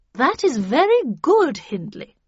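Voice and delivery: high, swooping encouragement; lilting, high-pitched approval; Miss Havisham praising. A narrator's reading. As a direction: praise him like a counsellor.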